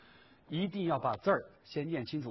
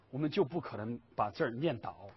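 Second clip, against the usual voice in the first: ordinary talk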